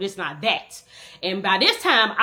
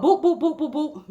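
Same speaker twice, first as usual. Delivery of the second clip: punching sound effects; 7 semitones higher than usual. Retold or imitated.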